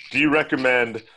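slow, high mechanical voice; robotic website voice. Pretend. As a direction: read it out like a dumb bot. Imitating website password prompt.

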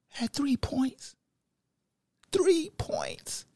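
whispered, slow, in disbelief; stunned whisper. Real, not acted. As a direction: breathe it out in shock.

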